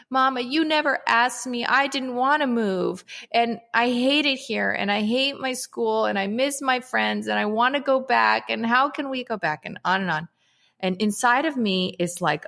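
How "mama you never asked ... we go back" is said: put-on higher, childlike pleading voice; imitating upset daughter. Mom retelling her daughter's bedtime complaint, higher childlike plea. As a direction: voice her little girl's tearful protest. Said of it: "She says".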